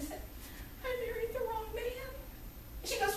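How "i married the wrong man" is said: high, soft, mock-tearful squeak; mock tearful confession. Daughter confessing to mother on the phone, mock tearful whimper. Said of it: "called my mother, sobbing".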